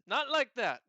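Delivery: high, loud flustered denial; flustered catfolk cuts in loudly; insistent correction. Acted. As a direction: wave off the misunderstanding loudly. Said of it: "she looks at you and says".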